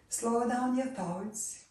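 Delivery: ordinary talk, nothing marked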